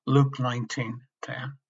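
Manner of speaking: ordinary talk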